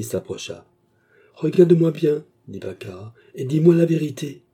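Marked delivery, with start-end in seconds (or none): 1.3-2.2 loud, high, insistent order; imperious command. A narrator's reading. Mistress confronting her maid, commanding. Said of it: "dit-elle impérieusement". 3.3-4.4 loud, high demand; imperious command. A narrator's reading. Mistress demanding truth from maid, commanding. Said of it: "dit-elle impérieusement".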